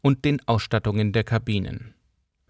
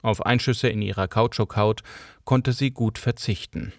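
ordinary delivery, nothing marked

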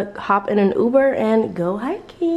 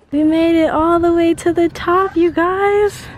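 excited; 9 semitones higher, 5 dB louder than usual. Real, not acted. Reached the top, excited.

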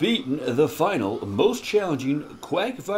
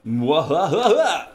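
evil cackle; 6 semitones higher, 5 dB louder, much slower than usual. Acted. Villain laugh after taunt.

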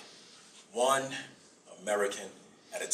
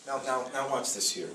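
usual speaking voice

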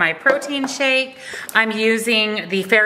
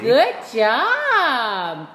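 pet voice; 3 semitones higher than usual. Real, not acted. Praising dog.

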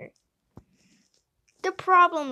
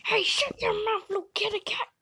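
scolding; 8 semitones higher, faster than usual. Acted. Cat scolding.

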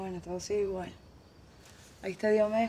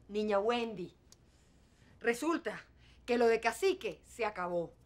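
ordinary talk

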